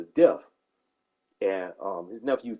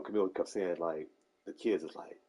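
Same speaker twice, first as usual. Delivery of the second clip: ordinary delivery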